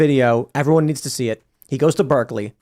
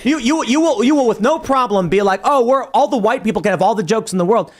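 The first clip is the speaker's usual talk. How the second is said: higher-pitched mock outrage at a chatbot; retold indignant protest; 7 semitones higher than usual. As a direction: argue back in an exasperated, raised pitch. Acts out arguing with the chatbot.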